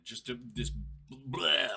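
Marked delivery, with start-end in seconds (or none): 1.1-1.7 loud, higher "bleh" like throwing up; mock vomit noise. Imitating the AI spewing out text, mock vomit noise. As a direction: spew it out as a comic retch. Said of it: "like, throwing up all these lines".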